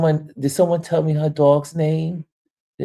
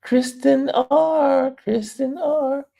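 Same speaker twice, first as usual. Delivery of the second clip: amused high repeat; 14 semitones higher, slower than usual. Real, not acted. Rereading the name amused.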